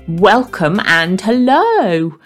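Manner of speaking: normal talking voice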